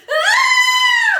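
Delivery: loud high shriek of fright; scared scream. Acted. Storyteller as little girl hearing the door squeak, scared scream.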